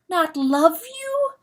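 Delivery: high, slow wailing question; keen the words in grief. Acted. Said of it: "keened Pertalote".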